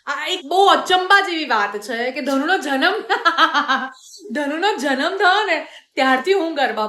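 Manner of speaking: talking through a laugh; giggling while telling it; real laughter in the words. Real, not acted.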